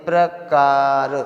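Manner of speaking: प्रकार drawn out in a chant; slow sing-song. Shown as a demonstration. Teacher to students, chanting the word slowly. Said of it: "अब इसका pronunciation करिए".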